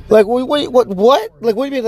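high, baffled questioning; acted bewildered protest; incredulous. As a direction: question it in rising disbelief. Acts disbelief at tip request.

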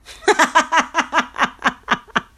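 loud, high run of laughter; real belly laugh. Real, not acted. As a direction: burst out in long staccato laughs. Cracks herself up.

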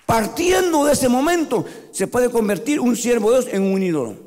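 fiery sermon at full voice; full-force preaching. Acted. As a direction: preach it out to the whole church. Preaching to the congregation.

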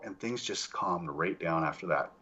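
usual speaking voice

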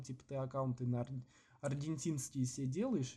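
usual speaking voice

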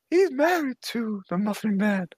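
put-on high, creepy old man voice; quavering old creep, high and thin; Herbert from Family Guy. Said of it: "you literally just sound like Herbert from Family Guy".

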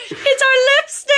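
high, thrilled squeal; surprised squeal. Retold or imitated. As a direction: squeal it like opening a gift. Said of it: "we're both like".

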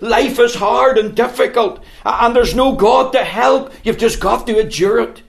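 mock the Epicureans' bleak advice; a hint of a weary philosopher; voicing the philosophers. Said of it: "these philosophers came along and said".

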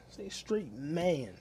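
each word stretched slowly; slow and deliberate; slow, drawn out. Shown as a demonstration. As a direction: spell it out slowly, like to a child.

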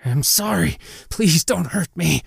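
high, pleading and scared; frightened, cowering plea; trembling with fear. A narrator's reading. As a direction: beg fearfully for mercy. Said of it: "was trembling with fear".

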